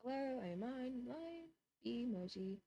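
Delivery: slow, soft, mock robotic monotone. Replying to her dad in a slow, soft robot voice. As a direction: type back like a deadpan robot. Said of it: "secretly been a robot".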